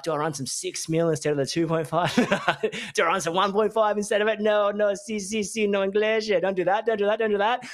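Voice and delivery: high, fast flustered tradie, laughing; a high, rushed mock panic over the cable; mock panicking tradie. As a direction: ham up a confused sparky at the cable run.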